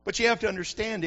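normal talking voice

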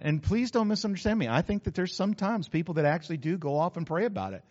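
ordinary talk, nothing marked

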